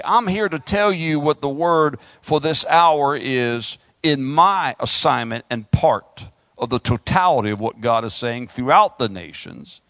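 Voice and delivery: usual speaking voice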